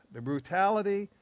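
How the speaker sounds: usual speaking voice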